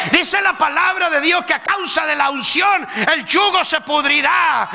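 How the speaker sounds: loud, high shouted sermon; fiery pulpit shouting; full-force preaching. Acted.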